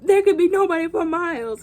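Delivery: high, loud panicky rant; imitated freaking out. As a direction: act out the frantic kids. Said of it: "They're all freaking out".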